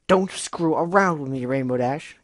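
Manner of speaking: loud, furious growl at her friend; angry bellow. Acted. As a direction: bellow it, fed up. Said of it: "Gilda bellowed angrily".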